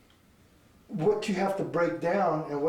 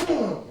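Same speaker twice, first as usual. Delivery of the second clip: booming strike noise, swooping in pitch; punchy vocal boom; imitated impact; 4 dB louder than usual. Shown as a demonstration. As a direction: voice the blow landing.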